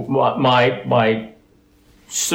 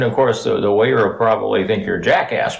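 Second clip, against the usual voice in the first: usual speaking voice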